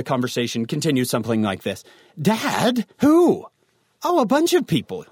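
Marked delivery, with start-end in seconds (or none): 2.2-3.5 high, loud incredulous demand; exasperated teen. Acted. Teen questions dad about the borrowed car, exasperated. 4.0-5.1 high, offhand dad reply; casual dad. Acted. Dad answers his worried teen about the borrowers, airily vague. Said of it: "your dad says".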